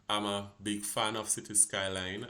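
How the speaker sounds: ordinary talk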